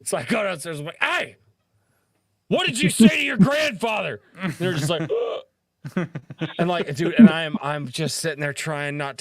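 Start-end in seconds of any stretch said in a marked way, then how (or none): 1.0-4.2 high, loud mock scolding; stern dad bellow, put on for show. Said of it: "I need you to use your dad voice". 5.8-6.5 sharp imitated gasp of shock; sudden mock-frightened intake of breath; startled gasp. Kids' shocked reaction to being yelled at.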